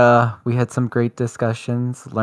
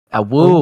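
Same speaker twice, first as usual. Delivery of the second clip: howling like a wolf pup; 4 semitones higher, 5 dB louder than usual. Pretend. Howl sign-off.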